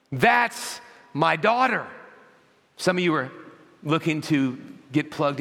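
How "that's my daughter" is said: high, slow, emphatic pride; raised voice of a delighted dad; proud father exulting. Acted. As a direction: savour each word of a proud boast. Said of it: "he's looking at you and he's saying".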